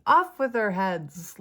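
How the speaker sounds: loud, high, imperious royal command; Queen of Hearts. Acted. Tarot reader acting out a feared queen, commanding. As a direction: proclaim the sentence slowly and loudly. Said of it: "seeing the Queen of Hearts from".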